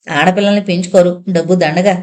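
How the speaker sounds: ordinary delivery, nothing marked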